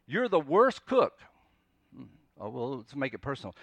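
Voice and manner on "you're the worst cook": loud, high mock accusation; crisis language. Someone insulting the cook in crisis language, loudly accusing. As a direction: blurt it out as an exaggerated complaint. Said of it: "It's these extreme, uh, words that we use".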